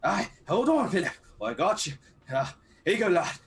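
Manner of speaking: urgent. Acted. Helping Philip with oxygen, urgent.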